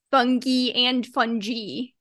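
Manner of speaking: fungi as FUN-ghee, then fungi as FUN-jee; two pronunciations. Shown as a demonstration. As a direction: slowly contrast fungi with hard g and fungi with soft g. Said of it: "with the soft G".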